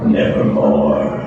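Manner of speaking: low, dark raven voice dropping into one word; ominous low raven croak; raven's croak. Acted. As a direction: let the bird answer from deep down. Raven answers in poem.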